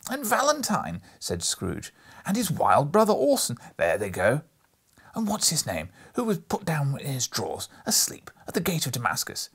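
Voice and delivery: put-on high, loud, breathless childlike excitement; between laughing and crying. A narrator's reading. Scrooge gushing over storybook heroes, half laughing, half crying. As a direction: gush with tearful glee. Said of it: "in a most extraordinary voice, between laughing and crying".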